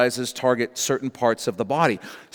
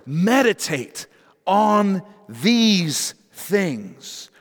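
"meditate on these things" drawn out very slowly, higher; slow emphasis; 5 semitones higher, much slower than usual. Shown as a demonstration. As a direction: stretch every word of "meditate on these things". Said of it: "underline that word".

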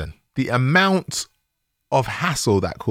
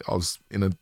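ordinary talk

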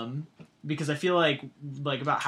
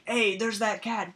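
higher, drawn-out call to the gang; mock alarm call; 7 semitones higher, slower than usual. Dogs spotting the cat who spied on them, mock alarmed call. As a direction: lightly act out a gang spotting an intruder. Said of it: "they're all like".

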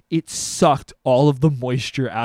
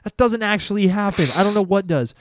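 mock scolding; 6 semitones higher, 4 dB louder than usual. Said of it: "I was like".

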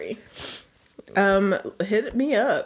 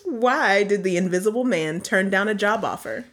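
normal talking voice

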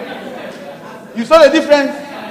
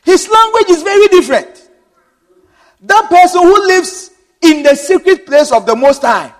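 preaching with lifted pitch and energy; rising sermon; 4 semitones higher than usual. Real, not acted. Preacher driving home the point to the congregation, lifted.

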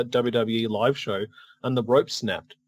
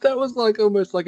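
excited; 10 semitones higher, 4 dB louder than usual. Real, not acted. Reacting to the strike.

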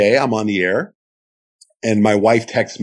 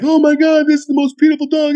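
high, loud gushing; retold squeal over a new dog; overcome with emotion; 4 dB louder than usual. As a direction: imitate a family overcome with joy. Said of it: "this family starts crying".

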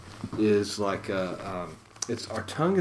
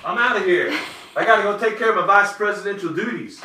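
mock exit; 12 semitones higher, 7 dB louder, faster than usual. Husband jokes about leaving for duties.

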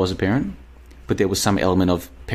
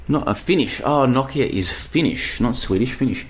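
usual speaking voice

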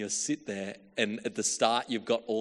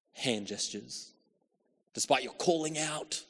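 ordinary talk

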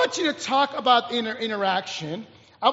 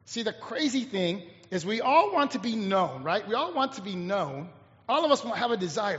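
ordinary talk

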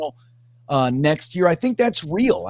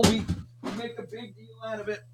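far off; 4 semitones higher, 4 dB louder than usual. Real, not acted. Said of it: "Were you walking down the stairs?".

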